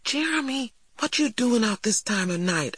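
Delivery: high-pitched surprised questioning; taken aback. A narrator's reading. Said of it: "I questioned, taken aback to see him".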